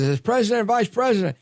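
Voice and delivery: high-pitched mock politician; mocking high-voiced quote; President Biden. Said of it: "And then he says".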